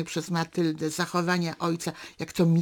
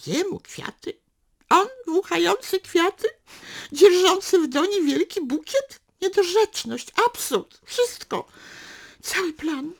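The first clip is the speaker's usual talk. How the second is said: much higher, mocking disbelief; incredulous scorn; 11 semitones higher, 5 dB louder than usual. Matylda mocking her own bouquet plan, incredulous and high. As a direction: scoff at the very idea.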